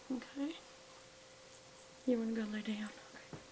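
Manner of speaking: high, soft sing-song cooing to a sick dog. Real, not acted. Owner coaxing her sleepy sick dog, high tender baby talk. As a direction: coax the little dog gently, like a worried mommy.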